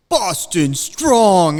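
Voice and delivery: loud, high, drawn-out chant; mocking rally holler; mock slogan shout. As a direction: bellow it slowly like a silly rallying cry.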